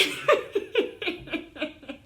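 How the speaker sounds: high, loud giggling; laughing fit. Real, not acted. After showing viewers the granny look, laughing. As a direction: burst into helpless giggles. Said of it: "Là, je rigole".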